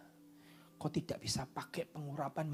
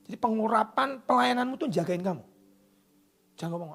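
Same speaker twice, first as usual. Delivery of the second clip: ordinary talk, nothing marked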